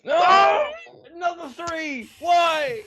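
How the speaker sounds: high-pitched cry of despair; anguished wail. Real, not acted. Player rolls another three, anguished wail. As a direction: wail in protest at rotten luck.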